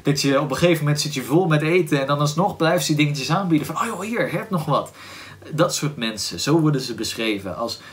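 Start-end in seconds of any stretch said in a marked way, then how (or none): 3.8-4.9 lightly voiced eager host, pitched up; a hint of pressing food on a guest, higher; insistent host. Warm parents offering yet another snack, lightly acted insistent host.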